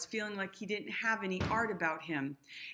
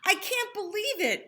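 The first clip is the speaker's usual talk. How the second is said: higher, joyful proclamation; proclaimed; 11 semitones higher, 6 dB louder than usual. Acted. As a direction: announce it with wonder. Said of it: "Invisible proclaimed".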